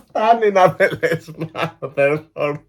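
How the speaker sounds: helpless laughter through the words; cracking up. Real, not acted. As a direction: barely get it out for laughing. Cracking up, cannot continue.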